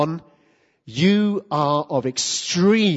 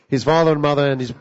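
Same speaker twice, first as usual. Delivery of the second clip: rushed, slightly louder rattle through the words; hurried alternative reading; 4 semitones lower, 3 dB louder, faster than usual. Shown as a demonstration. Preacher tries another way to read Jesus' words, hurried and offhand. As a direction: gabble it off as a throwaway. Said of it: "I'm sure Jesus didn't say it like this".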